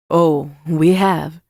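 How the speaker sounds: slow, loud, drawn-out knowing reply; drawling big-brother voice, amused; teasing male character. A narrator's reading. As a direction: stretch it out with smug relish. Narrator voices Xavier teasing Amani.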